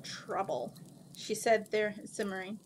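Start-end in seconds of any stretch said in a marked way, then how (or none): 0.0-0.7 low, soft single word; quiet, lowered aside; hushed reply. Acted. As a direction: answer grimly under your breath.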